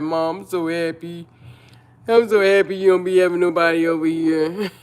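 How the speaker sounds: put-on a light, quick boyish voice for her son; imitating her son. Son telling his mom he is glad, mildly imitated. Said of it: "He be like".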